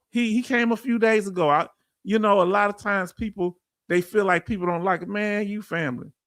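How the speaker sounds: ordinary talk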